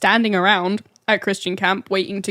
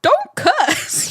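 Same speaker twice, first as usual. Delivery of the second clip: high-pitched panicked scolding, imitating a crowd; mock panic. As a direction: squeal it like a scandalised onlooker. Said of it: "Everyone just panicking".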